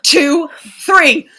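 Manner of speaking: loud, rising, determined count; rally them, building to three; loud countdown. Acted. Counting down before jumping flames.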